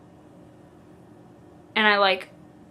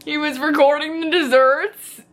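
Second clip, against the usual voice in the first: higher and louder, in disbelief; incredulous repeat; 4 semitones higher, 4 dB louder than usual. Real, not acted. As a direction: say it like you can't believe it.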